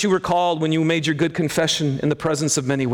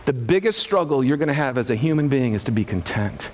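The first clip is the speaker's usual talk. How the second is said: normal talking voice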